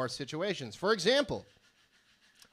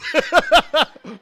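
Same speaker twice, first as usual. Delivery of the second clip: shrieking high laugh, much louder; high loud cackle; 13 semitones higher, 15 dB louder than usual. Real, not acted. Laughing loud and high after someone in the crowd screams no.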